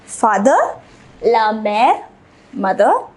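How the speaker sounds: normal talking voice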